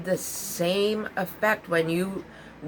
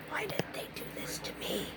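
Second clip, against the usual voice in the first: put-on hushed, whispered old self-pity; 12 dB quieter than usual. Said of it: "I was like".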